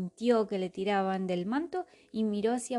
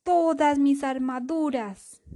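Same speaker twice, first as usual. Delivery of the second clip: angry king voice; 6 semitones higher, 6 dB louder, slower than usual. Acted. King furious over his armour.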